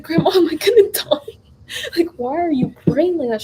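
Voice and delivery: half-laugh as you ask if you're dying; laughing alarm. Real, not acted. To grandma praying over her sickbed, a laughing mock panic.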